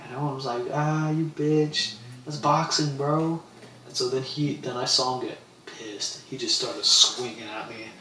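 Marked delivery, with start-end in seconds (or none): 0.7-3.4 higher, very slow mocking jeer; crowd jeering. Party crowd jeering the complainer, slow mocking. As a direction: drag it out to taunt him. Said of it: "everyone kind of bitched him".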